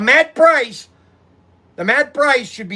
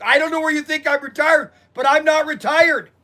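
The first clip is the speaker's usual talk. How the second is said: indignant protest; 5 semitones higher than usual. Retold or imitated. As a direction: protest hard that you're not retired. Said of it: "I go".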